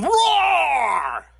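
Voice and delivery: loud, high bear roar; grumpy roar. Acted. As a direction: roar to scare the goslings off. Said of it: "became extra grumpy".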